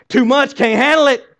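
high, loud mock protest; mock overwhelmed. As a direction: throw up your hands like the shocked religious folk. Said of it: "they're like".

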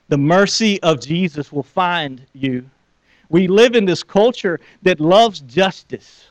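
ordinary delivery

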